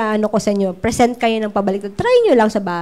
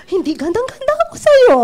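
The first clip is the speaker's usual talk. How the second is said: put-on gushing old lady; 12 semitones higher, 3 dB louder than usual.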